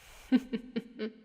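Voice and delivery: breathy villainous chuckle; teasing chuckle. Acted. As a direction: chuckle in short, amused pulses. Mocking the servant's new look.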